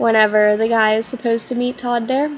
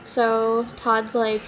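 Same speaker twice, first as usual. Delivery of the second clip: ordinary talk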